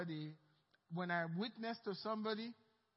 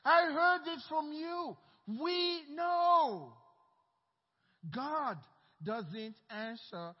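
higher, louder, slowing accusation; rising confrontation; 5 semitones higher, 5 dB louder, slower than usual. Acted. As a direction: throw their teaching back at them. Blind man confronts leaders.

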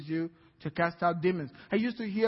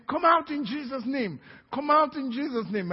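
raised, higher commanding call; retold yelling command; 6 semitones higher than usual. Preacher recalling yelling at the demon to come out. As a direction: cry out the command to leave. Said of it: "And I was yelling".

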